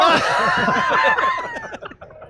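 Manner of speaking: shrieking burst of laughter. Real, not acted. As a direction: let out a big high laugh. Big laugh after the joke.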